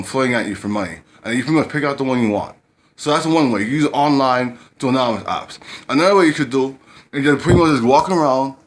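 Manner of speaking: ordinary delivery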